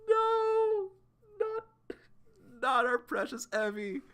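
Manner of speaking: high, wailing mock cry; high, hammed-up sobbing plea. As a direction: wail it out in a high voice. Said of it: "And Evie's crying".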